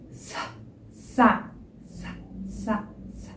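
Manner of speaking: soft, breathy "sa" syllables; unvoiced sa. Shown as a demonstration. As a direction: repeat "sa" quietly with a hiss. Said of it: "Você vai colocar voz nesse S". ("sa" sussurrado)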